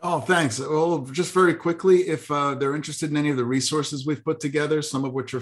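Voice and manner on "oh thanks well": ordinary delivery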